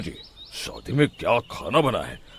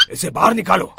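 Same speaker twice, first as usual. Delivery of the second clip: loud, higher annoyed command; irritated order; 8 semitones higher, 6 dB louder than usual. Acted. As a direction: snap out the order. Tantrik orders man thrown out.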